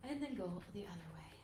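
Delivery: softly whispered instruction; hushed, nearly voiceless; whispered cue. Real, not acted. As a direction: whisper the next move gently. Hushed cue during side stretch.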